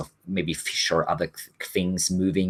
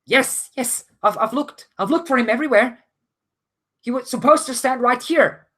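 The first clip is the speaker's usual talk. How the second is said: high-pitched anxious deckhand; frightened female character, high but not shouting; shaken, afraid. Acted. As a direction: insist frantically, stumbling over the words. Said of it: "she looks pretty shaken and".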